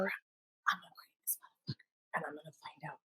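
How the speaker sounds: hushed, voiceless threat; whisper. Retold or imitated. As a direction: whisper it through the teeth. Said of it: "and she goes".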